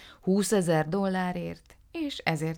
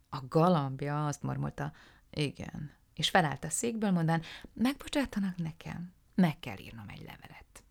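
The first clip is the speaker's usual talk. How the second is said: ordinary delivery, nothing marked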